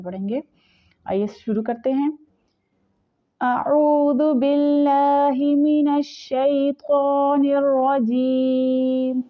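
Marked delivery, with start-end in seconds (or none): 3.4-9.2 slow melodic tajweed chant; Quranic recitation. Shown as a demonstration. Teacher demonstrating the recitation to students, slow melodic chant. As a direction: draw each word out in a measured chant.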